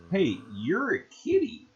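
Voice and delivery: cat talk. Real, not acted. Talking to the cat.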